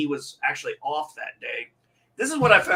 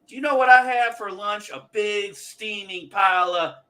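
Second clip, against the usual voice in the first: higher-pitched, lightly acted old man; movie old man; 6 semitones higher than usual. Acting out a movie scene about lunch, lightly hammed-up old man. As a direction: savour it like a proud old-timer. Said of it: "what was that scene from Grumpier Old Men".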